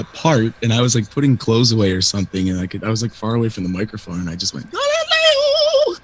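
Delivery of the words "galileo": high, slow operatic belt; opera lady voice. Retold or imitated. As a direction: belt it like an opera diva at the top of your lungs. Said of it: "in my opera, fat opera lady voice".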